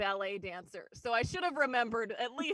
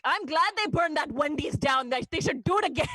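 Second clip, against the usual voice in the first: put-on loud, higher Indian-accented mom; mom's Indian accent; 4 semitones higher, 8 dB louder than usual. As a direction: imitate an outspoken mother with an Indian accent. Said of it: "It just hits different in an accent".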